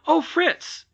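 put-on young boys calling out in thrilled surprise; excited exclamation. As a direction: burst out in delight at a brother. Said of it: "exclaimed his brothers".

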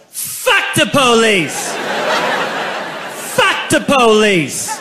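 slow, drawn-out rowdy yell; drunken yell. Retold or imitated. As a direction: bellow it like a drunk teen at a party. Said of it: "I yelled".

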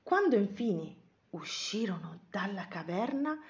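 plain reading voice

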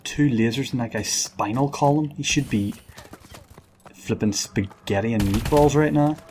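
ordinary delivery

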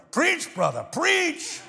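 mock yell, pitch leaping high on last preach; imitating deacons' yell. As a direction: call out like a deacon in the front row. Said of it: "the deacons yell".

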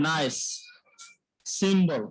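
নাইস and সিম্বল with a hissing s; teacher modelling নাইস, সিম্বল; s sound demo. Shown as a demonstration. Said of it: "উচ্চারণটা হয়". (নাইস, সিম্বল স্পষ্ট s দিয়ে)

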